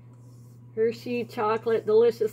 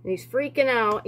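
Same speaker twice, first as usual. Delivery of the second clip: ordinary talk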